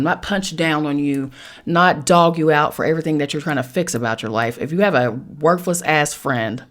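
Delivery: ordinary talk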